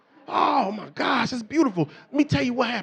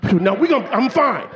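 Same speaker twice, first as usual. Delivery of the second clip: normal talking voice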